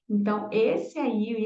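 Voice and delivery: ordinary talk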